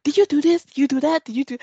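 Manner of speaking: high, loud string of questions; imitated nagging husband. Wife mimics husband quizzing her about calls, nagging.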